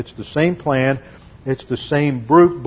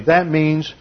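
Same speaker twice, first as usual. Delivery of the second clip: normal talking voice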